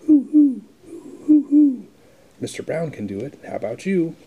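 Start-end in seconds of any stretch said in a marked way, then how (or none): 0.1-1.9 high loud hooting owl; owl hoots. Acted. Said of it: "He can sound like an owl".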